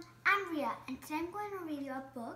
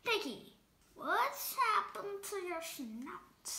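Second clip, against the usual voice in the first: plain reading voice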